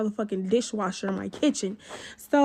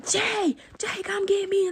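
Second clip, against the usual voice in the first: mock scream; 8 semitones higher, slower than usual. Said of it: "she started screaming".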